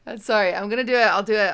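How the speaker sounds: loud, hurried self-push; insisting. Real, not acted. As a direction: loudly insist through the giggles. Composing herself after laughing fit.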